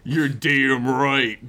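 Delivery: slow sneering bully voice. Acted. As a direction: sneer it out slowly. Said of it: "[name] sneered".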